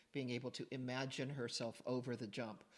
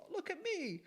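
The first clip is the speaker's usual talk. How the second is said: very high-pitched mock cry; high, hammed-up reaction; mock dismay; 4 dB louder than usual. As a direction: squeak it out at the sight of yourself. Retelling reaction to own video.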